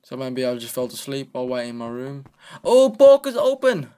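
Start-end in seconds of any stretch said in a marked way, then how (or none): 2.6-3.9 high, loud and stretched-out call; excited cry. Real, not acted. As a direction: call out to the open shop, thrilled. Excited greeting to a friend.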